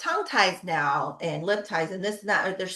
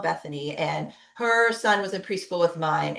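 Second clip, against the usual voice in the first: ordinary talk, nothing marked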